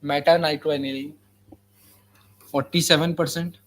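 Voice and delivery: very slow, word-by-word dictation; dictating. Shown as a demonstration. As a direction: spell it out slowly for copying. Dictating notes to students.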